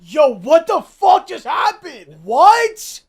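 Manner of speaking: loud, stunned disbelief; shocked shout. Real, not acted. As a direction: yell it out in shock. Shocked reaction to clip.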